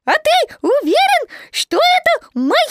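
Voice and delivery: very high, loud piglet squeak; excited squeak. A narrator's reading. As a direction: squeak it out, all aflutter. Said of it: "взволнованно запищал Пятачок".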